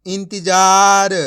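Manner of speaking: ordinary delivery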